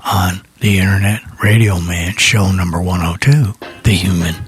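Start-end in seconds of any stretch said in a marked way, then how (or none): none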